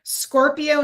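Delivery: ordinary talk, nothing marked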